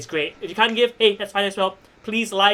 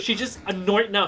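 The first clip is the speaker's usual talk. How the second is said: ordinary talk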